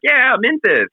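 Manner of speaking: pushy hype voice. Retold or imitated. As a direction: hype it up like a shill. Retold shill urging mint.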